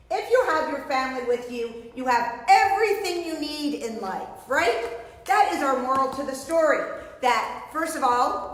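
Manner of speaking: ordinary delivery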